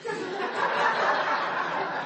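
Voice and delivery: long, high derisive laugh; mocking laugh. God looking down on Xerxes, laughing in mockery. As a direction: laugh down at a puffed-up king. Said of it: "God looked down and laughed".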